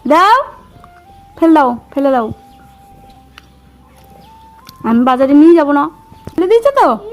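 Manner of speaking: loud, sharp orders; angry scolding. Real, not acted. Mother orders it thrown away. (রাগী গলায় আদেশ)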